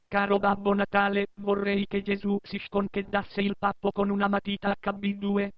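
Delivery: put-on high squeaky kid voice; child voice. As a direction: read it like a small child. Child's letter to Santa.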